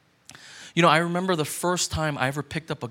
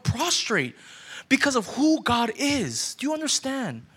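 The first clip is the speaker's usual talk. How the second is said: raised pitch, urgent sermon delivery; impassioned preaching; 7 semitones higher than usual. Real, not acted. Pastor to congregation on fearing who God is, impassioned preaching. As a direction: preach it hard, driving each phrase home.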